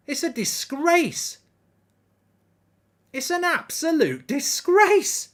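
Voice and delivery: slow, high, outraged protest; outraged disgust. Real, not acted. Fan fuming at the VAR for a missed penalty, slow outraged cry. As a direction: drag each word out in disbelief.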